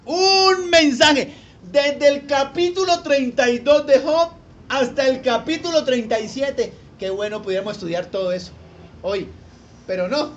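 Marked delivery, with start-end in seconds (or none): 0.0-6.7 excited exclamation. Real, not acted. Preacher exclaims about Elihu.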